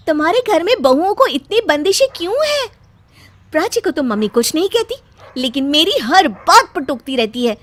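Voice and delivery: angry complaint. Acted. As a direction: vent at the husband. Bride complains to husband.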